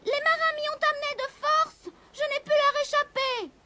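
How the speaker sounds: high, small kitten voice calling for help; a frightened little cat shouting from the mast; kitten crying out. A narrator's reading. The kitten calls desperately to the witch, high-pitched.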